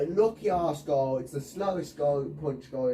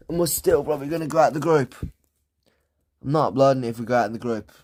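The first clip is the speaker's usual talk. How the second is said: loud, rushed complaint; fast angry rant; 2 semitones lower, 7 dB louder, much faster than usual. Real, not acted. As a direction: rattle off the frustration. Ranting about losing.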